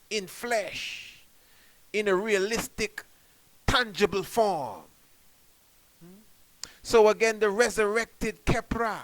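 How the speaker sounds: fiery sermon climax, each phrase ringing; preaching at full force. Acted. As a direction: proclaim it like a revival preacher, slowly. Preaching peak.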